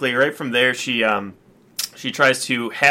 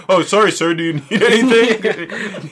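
mock polite cop; 5 semitones higher, 4 dB louder than usual. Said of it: "they're just like".

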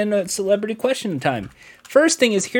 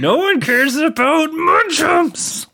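mock voice; 10 semitones higher, slower than usual. Mocking the munch segment.

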